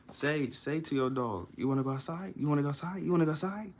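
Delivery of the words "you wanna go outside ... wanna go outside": higher, eager dog talk climbing with each repeat; excited, energetic pet-owner voice; enthusiastic. Shown as a demonstration. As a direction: rev the dog up with rising questions. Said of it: "Say it enthusiastically".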